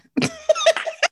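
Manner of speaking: high squealing laugh. Real, not acted. Host laughing at her mom's reply, squealing laugh. After quoting mom.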